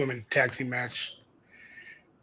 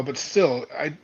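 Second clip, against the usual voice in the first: normal talking voice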